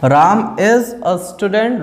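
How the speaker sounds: very slow, each word of राम is a student set apart; slow dictation. Shown as a demonstration. Teacher dictating an example slowly to students.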